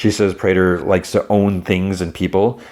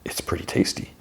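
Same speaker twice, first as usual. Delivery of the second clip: voiceless hush; whisper; 10 dB quieter than usual. Retold or imitated. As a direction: breathe it out under the breath. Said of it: "And he whispers".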